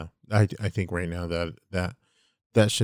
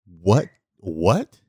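incredulous; 8 dB louder than usual. Retold or imitated. Aunt's disbelieving reaction, retold.

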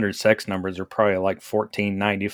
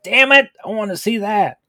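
excited; 11 semitones higher, 5 dB louder than usual. Retold or imitated. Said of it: "I'm like".

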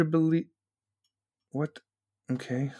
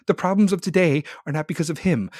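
usual speaking voice

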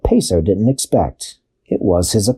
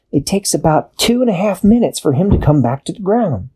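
much higher matter-of-fact answer; high Dashi voice; 8 semitones higher than usual. A narrator's reading. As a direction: explain it lightly as Dashi. Dashi explains.

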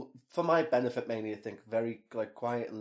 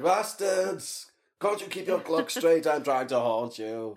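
annoyed ghost rant; 3 semitones higher, 4 dB louder, slower than usual. Pretend. Demon ranting at clocks.

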